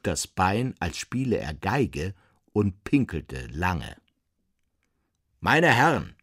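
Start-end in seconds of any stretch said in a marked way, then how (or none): 5.4-6.1 loud, higher-pitched call for attention; sudden raised-voice exclamation; bellowing. A narrator's reading. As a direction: bellow it to the gentlemen. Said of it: "brüllte Herr Nulitschek".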